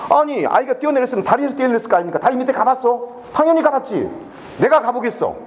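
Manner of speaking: high-pitched, indignant back-and-forth; heated argument. Retold or imitated. Official argues with rescuers about searching under the bridge, heated. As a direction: re-enact a quarrel, pressing each point high.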